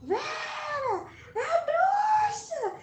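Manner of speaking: put-on a child screaming in panic after seeing the blind sister; terrified scream. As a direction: scream for help as if the witch is behind you. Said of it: "eu comecei a gritar".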